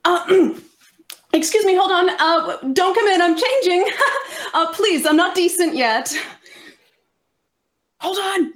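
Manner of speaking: high-pitched, flustered plea; flustered calling out. Acted. Stalling whoever knocked at the door while changing, high and flustered. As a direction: call out in a high voice, half laughing.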